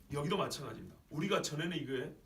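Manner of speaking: ordinary talk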